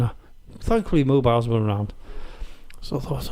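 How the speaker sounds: usual speaking voice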